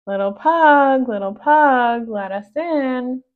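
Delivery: loud call at the door. Acted. Princess calls to the pug through the door, loud and slow. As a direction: knock and call out to be let in.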